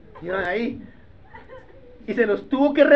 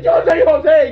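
shouted reveal; 7 semitones higher, 11 dB louder than usual. Acted. Joseph shouting to stunned brothers, then their gasp. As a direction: shout it and let them gasp. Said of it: "y míralo cómo grita".

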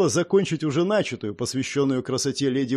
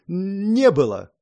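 slow, halting worried stutter; anxious stammer; 4 semitones higher, 3 dB louder, much slower than usual. A narrator's reading. Orson answers Elmerik about the missing girl, stammering anxiously. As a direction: get stuck on the first sound. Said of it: "От волнения он заикался даже больше обычного".

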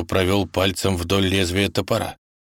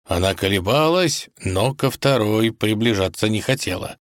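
ordinary delivery

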